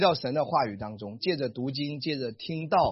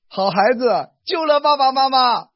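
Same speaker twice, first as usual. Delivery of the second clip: grateful praise; 8 semitones higher, 10 dB louder than usual. Shown as a demonstration. Thanking child.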